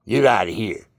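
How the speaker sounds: normal talking voice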